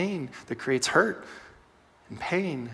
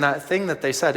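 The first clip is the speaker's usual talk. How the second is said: ordinary talk, nothing marked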